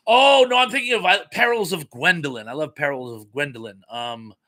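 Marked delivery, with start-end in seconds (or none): 0.1-2.5 loud, high "oh no" easing down; sudden realization. Real, not acted. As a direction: blurt out the correction. Catches own mistaken film title.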